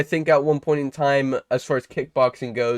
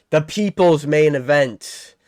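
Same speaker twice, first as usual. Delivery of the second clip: fight announcer; 4 dB louder, slower than usual. Acted. Hyping the main event fighter.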